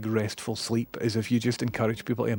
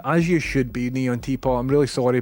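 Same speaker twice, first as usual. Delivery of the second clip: normal talking voice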